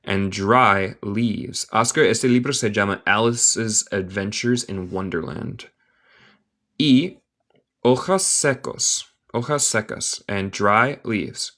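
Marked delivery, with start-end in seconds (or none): 0.0-1.7 slow model reading. Shown as a demonstration. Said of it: "teacher models phrase slowly". 10.3-11.5 slow model reading. Shown as a demonstration. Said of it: "teacher models phrase slowly".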